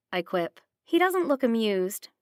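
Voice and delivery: ordinary delivery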